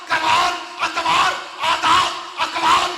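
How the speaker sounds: loud, slow, chanted list of virtues; full-force preaching. Acted. Preacher listing God's gifts to man, booming chanted delivery. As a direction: hammer each word out to the crowd.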